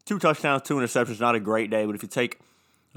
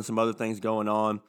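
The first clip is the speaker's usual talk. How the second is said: ordinary talk, nothing marked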